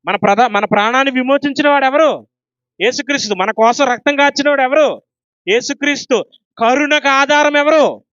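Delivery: loud proclamation. Shown as a demonstration. Preacher rousing the church to shout the answer, loud. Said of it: "గట్టిగా అరిచి చెప్పాలి ఇది".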